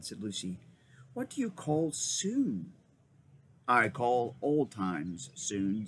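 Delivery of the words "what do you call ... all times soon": slow. Acted. Lucy and Aslan exchange.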